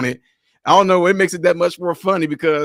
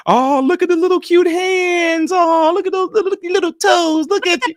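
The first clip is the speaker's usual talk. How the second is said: hammed-up doting baby voice, much higher; baby talk; 12 semitones higher than usual. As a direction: coo at the baby's little hands and toes. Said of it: "you sit there talking about".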